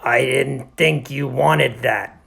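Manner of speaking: loud, slow workman voice; Milo the janitor. Acted. Janitor explains taking the period, defensive. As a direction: drag out the excuse.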